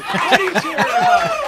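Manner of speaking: host repeating the question while laughing; laughing squeal. Pretend. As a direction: crack up while shouting it again.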